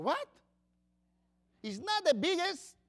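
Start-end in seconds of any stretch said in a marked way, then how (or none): none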